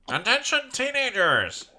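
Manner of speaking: high loud PA announcement, drawn out; announcer voice. Acted. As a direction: call it out like a school intercom.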